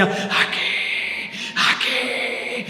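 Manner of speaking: half-voiced phantom cry; headless pirate's voice. A narrator's reading. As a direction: stretch it out like a headless ghost calling. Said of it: "se escuchaba una voz de un pirata".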